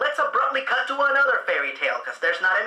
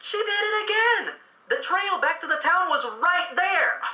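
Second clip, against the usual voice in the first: much higher, frustrated cry; exasperated disbelief; 8 semitones higher than usual. Real, not acted. Frustrated at character.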